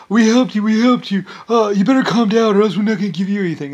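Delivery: high, loud mocking whine; shrieky mimicry of naggers; mocking quote. Mock-quotes all these people.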